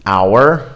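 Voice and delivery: "hour" with no H at all; silent H. Shown as a demonstration. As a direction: start "hour" straight on the vowel. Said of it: "You just drop it".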